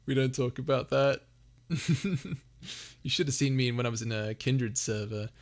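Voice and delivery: ordinary talk, nothing marked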